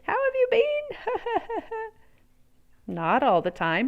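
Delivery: usual speaking voice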